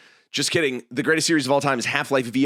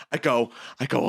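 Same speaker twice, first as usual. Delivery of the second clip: ordinary talk, nothing marked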